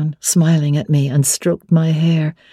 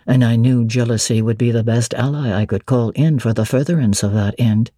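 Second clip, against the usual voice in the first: voiced lower as the brooding master; Mr. Rochester; 6 semitones lower than usual. A narrator's reading. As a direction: drop low and weighty, wary of her question.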